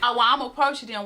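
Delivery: usual speaking voice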